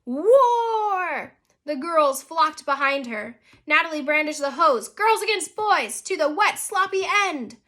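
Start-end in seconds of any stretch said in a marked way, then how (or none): 0.0-1.4 long, high, loud yell; drawn-out rallying cry; war cry. A narrator's reading. Rallying girls to water fight. 4.9-7.6 high, loud battle speech; rally the troops for a water fight; raised-voice challenge; rallying cry. A narrator's reading. Rallying speech with brandished hose.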